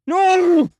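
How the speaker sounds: piercing high anguished scream; cried out. A narrator's reading. Jason sees his friend shot, anguished high scream. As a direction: scream it with everything. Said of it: "Jason cried".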